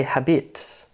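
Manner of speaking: "habit" stressed on its second syllable, ha-BIT; wrong stress on "habit". Shown as a demonstration. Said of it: "it would be weird to say".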